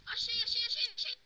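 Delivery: high, loud excited repeats. Real, not acted. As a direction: blurt it out thrilled. Found something in game.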